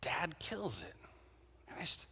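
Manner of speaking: breathless hushed whisper. Acted. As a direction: whisper it like a dark secret. Kid realizes the pattern, awed.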